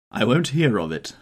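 ordinary delivery, nothing marked

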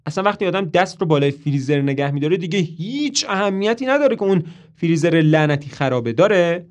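loud, fed-up snapping; exasperated. A narrator's reading. Toby snapping at Marilyn about the freezer, loud and exasperated. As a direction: bite each word out in irritation. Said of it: "توبی با حرص میگه".

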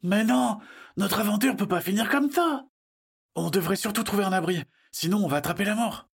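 put-on high, brisk child's protest; insistent child. Child urging friends to find shelter, high insistent voice. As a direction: object urgently in a high young voice.

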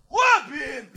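high, squeezed mock yell; thin high-pitched call; imitated crowd heckle. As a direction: shout a name like a distant fan, without volume. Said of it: "Och då är någon så".

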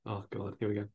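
ordinary talk

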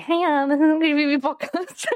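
self-mocking high-pitched podcast greeting; high intro voice. Shown as a demonstration. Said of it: "But when I say".

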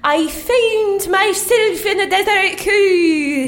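very high, slow wailing declamation; overwrought monologue. Acted. Actress lost in dream desert, overwrought delivery. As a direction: stretch the words into a high theatrical wail. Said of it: "Break 'em down and then build 'em back up".

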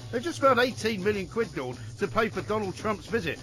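brisk presenter trailing the next topic; radio trail. Acted. Host previewing police funding debate, upbeat radio trail. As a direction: tease what's coming up this hour.

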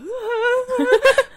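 high, loud, sustained wobbly wail; mock wail of someone circling lost; imitated helpless noise. Imitating lost boyfriend going round in circles, mock wail. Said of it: "då ser jag han så här".

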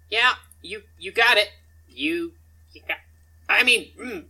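high, loud, broken-off stammer; acted nervous goblin; flustered agreement. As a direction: trip over the words while agreeing, then clam up.

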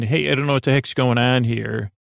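ordinary talk, nothing marked

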